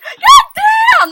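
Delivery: very high, loud exclamation; dismayed shout. Retold or imitated. Girl caught correcting a stranger, dismayed shout. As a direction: burst out with the curse in mock panic. Retakes her dismay at being overheard.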